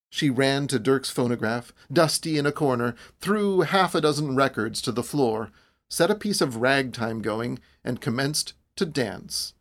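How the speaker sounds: ordinary delivery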